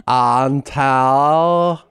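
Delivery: "until" stretched out as "un-taaa"; mock drawn-out "until"; girl-speak vowel. Shown as a demonstration. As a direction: drag the last vowel of "until" wide open. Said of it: "women did not talk like that until".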